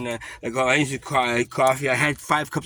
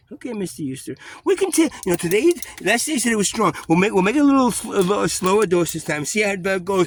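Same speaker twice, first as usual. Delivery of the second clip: much higher, louder mock-sweet voice; sugary nurse; 10 semitones higher, 6 dB louder than usual. Nurse coos to him about the dose, much higher mock-sweet voice.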